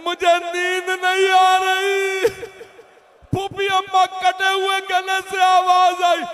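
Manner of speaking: high, loud, drawn-out wailing recitation; high mourning chant rising to a cry; grieving child's lament. Acted. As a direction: wail it out, holding the last vowels long.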